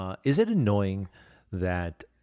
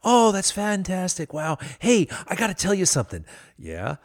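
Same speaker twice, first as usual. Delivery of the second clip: fan gushing; 9 semitones higher, 8 dB louder than usual. Retold or imitated.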